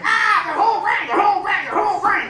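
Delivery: put-on excited kid voice. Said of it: "He's like".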